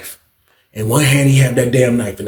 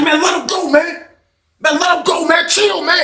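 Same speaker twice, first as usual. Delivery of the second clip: high shouted plea; retold yell; 12 semitones higher than usual. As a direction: yell over and over to let him go. Yelling at attacker to stop.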